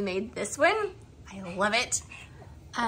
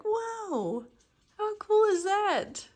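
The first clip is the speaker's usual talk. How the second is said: amazed whoa; 10 semitones higher, much slower than usual. Retold or imitated. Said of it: "I was like".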